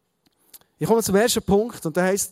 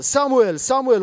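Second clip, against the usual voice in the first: loud, higher call of a name; 6 semitones higher, 6 dB louder than usual. A narrator's reading. The Lord calls the boy once more, loud calling. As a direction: call out insistently, each name falling at the end. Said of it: "der Herr rief noch einmal".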